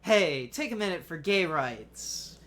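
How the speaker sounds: loud, slow canvasser call; tired street pitch. Retold or imitated.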